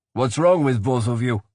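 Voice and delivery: a touch of demand in the question; lightly voiced demanding brother. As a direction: press them for an answer. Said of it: "he demanded".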